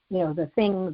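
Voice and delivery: normal talking voice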